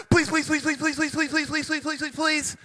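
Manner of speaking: high, breakneck string of pleading; hammed-up begging on his knees; good beggar. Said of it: "the guy's such a good beggar".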